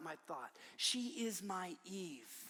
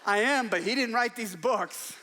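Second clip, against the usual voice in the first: laughing reply; 10 dB louder than usual. Retold or imitated. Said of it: "And she goes".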